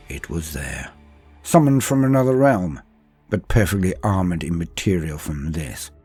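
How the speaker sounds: usual speaking voice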